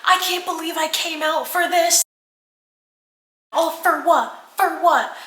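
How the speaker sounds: high, imitated distraught rant; going off. Imitating girlfriend going off on FaceTime, distraught and high. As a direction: pitch it up into a mock outraged wail. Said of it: "She starts, like, going off on this thing".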